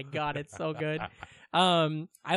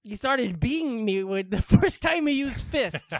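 higher, slow goat character voice; talking goat; 5 semitones higher, 3 dB louder, slower than usual. Retold or imitated. Goat telling of beatings with fists, character voice. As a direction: tell it as the put-upon goat. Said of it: "The goat is".

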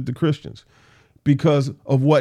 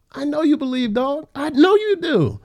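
much higher, teasing sing-song jab; playful teasing; 12 semitones higher than usual. Acted. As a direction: needle him with a grin you can hear. Playful jab at King Agrippa.

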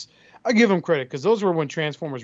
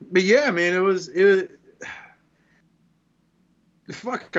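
ordinary talk

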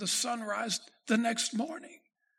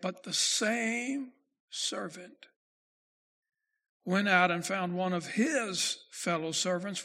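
measured, lower scripture-reading voice; 3 semitones lower, slower than usual. Acted. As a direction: read the verses slowly and solemnly. Reads verse twenty-eight.

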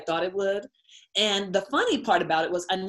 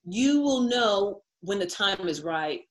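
slow emphatic; much slower than usual. Real, not acted. Advice repeated as a slow echo.

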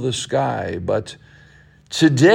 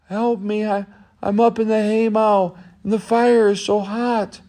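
put-on higher young boy's voice pleading for help; boy in the haymow calling for rescue, higher pleading voice; trapped boy; 8 semitones higher than usual. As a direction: play the scared boy stuck in the fire.

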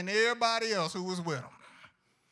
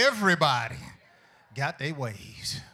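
louder, then slowing down; call and response; 5 semitones lower, 5 dB louder than usual. Shown as a demonstration. Preacher leading congregation to tell a neighbor, louder. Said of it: "Look at somebody, say".

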